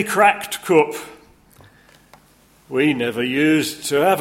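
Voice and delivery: high, loud broad Yorkshire voice; Yorkshire accent. Retold or imitated. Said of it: "try my, uh, Yorkshire accent in the different, uh, character voices".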